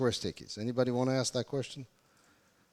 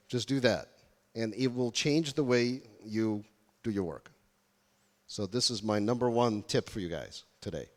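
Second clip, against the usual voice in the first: ordinary delivery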